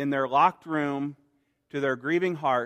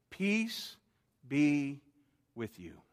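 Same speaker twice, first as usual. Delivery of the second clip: tender; 2 semitones lower, 8 dB quieter, much slower than usual. Acted. Jesus speaking peace to each.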